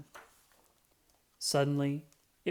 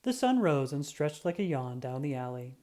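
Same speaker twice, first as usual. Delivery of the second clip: plain reading voice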